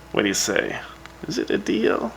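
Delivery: ordinary talk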